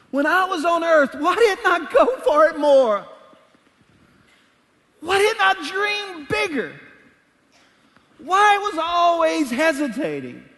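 high, loud lament of regret; regretful future self. Pretend. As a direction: cry out your regrets like a soul looking back. Imagined regretful self in heaven.

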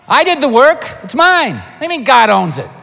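hammed-up protest over what's mine; a churchgoer loudly claiming his money; mock indignant protest. Objector protests, pitched way up.

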